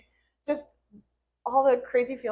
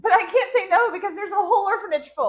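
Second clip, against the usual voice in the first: laughing; 11 semitones higher, 6 dB louder than usual. Real, not acted. Laughing about the full orphanage.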